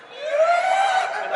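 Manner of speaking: high, loud whoop of victory; triumphant yell. Retold or imitated. Skater landing the drop-in, high triumphant whoop. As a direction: whoop like you just nailed it.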